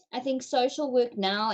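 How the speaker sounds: ordinary talk, nothing marked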